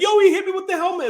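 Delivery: mock the player complaining to the refs; whiny complaint. Rudolph complaining after getting hit, high fast whine. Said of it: "he tried to complain".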